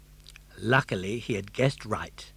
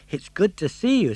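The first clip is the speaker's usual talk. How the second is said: put-on high, bright puppet boy voice; a determined young puppet, raised pitch; Pinocchio; 6 semitones higher, 6 dB louder than usual. Puppet boy urging his father, high and eager.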